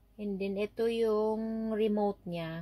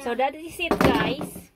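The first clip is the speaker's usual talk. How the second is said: yelling across the room; 6 semitones higher, 9 dB louder than usual. Real, not acted. Raises voice to camera over child.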